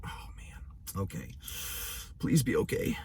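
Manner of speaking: breathless whispered plea, barely audible; hushed worried whisper under the breath; anxious whisper. Retold or imitated. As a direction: whisper it to yourself, dreading what comes. Nervous inner plea at ultrasound.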